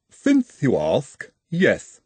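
measured, formal Igor voice with a foreign lilt; slow, polite and ominous Igor. Acted. Igor admits his origin.

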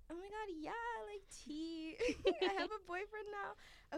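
mock yourself as a giddy airhead; put-on high girly excitement with a laugh; naive younger self. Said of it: "my dumb ass was like".

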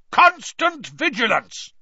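sudden loud, high roar, drawn out; booming slow command that makes them jump. A narrator's reading. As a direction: roar it at the whole class. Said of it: "he roared".

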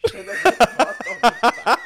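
loud, high, laughing through words; laughing hard. Real, not acted. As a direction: burst out laughing while repeating. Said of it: "il va falloir qu'on arrête de rire".

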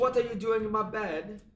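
high, loud cartoon British accent; mock British outrage. Partner confronting someone in bed, loud mock British accent. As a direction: demand it like an outraged Peppa Pig.

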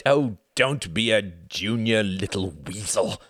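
slow, scornful sneer; looking down on him; heavy contempt. A narrator's reading. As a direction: boss sneering at his junior, heavy contempt. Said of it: "with heavy contempt".

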